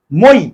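মই cut off short, stopping abruptly on the ই; clipped glide. Shown as a demonstration. Teacher showing students মই stops short. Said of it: "ধাক্কা মেরে থেমে যাচ্ছে".

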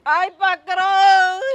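loud, slow, sobbing wail; crying plea. Acted. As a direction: wail at the boss for mercy. Worker threatened with jail.

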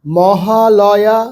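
very slow, stretched syllables; broken into syllables. Shown as a demonstration. Teacher reading four-letter words for beginners, syllable by syllable. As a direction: draw out each syllable of আনারস, Mahalaya. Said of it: "ভেঙে ভেঙে পড়বে".

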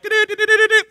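high mock alarm chirp; quick mock siren beeps; alarm beeping. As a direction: sing out a radar alert. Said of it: "something trips in your head".

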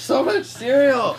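loud, high, drawn-out excitement; stretched, high-pitched burst of joy; thrilled exclamation. Real, not acted. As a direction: cry out in real delight. Thrilled on opening a big box.

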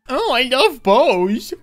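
mock gushing. Gloating about bow.

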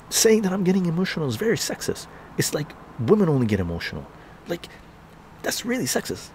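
high, quick, offended complaint; mock indignant mother. Imitating the mad mother accusing him of sexism, mock indignant. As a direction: imitate her rattling off an upset accusation. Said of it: "she got really mad".

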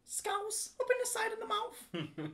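high-pitched attempt at Scouse; Scouse accent. Shown as a demonstration. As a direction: squeeze Scouse out the side of the mouth. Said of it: "working on my scouse".

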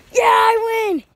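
high loud victory shout; excited cheer. Real, not acted. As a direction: shout it like you just won. Winning the game.

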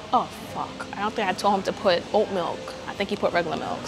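ordinary delivery

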